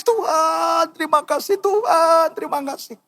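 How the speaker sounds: high, wailing thanks to God; reenacted crying. Preacher reliving tearful thanks to God on the plane, crying. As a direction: act out weeping in prayer, pitched high. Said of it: "saya nangis".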